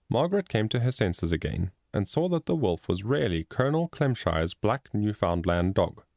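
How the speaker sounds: ordinary delivery, nothing marked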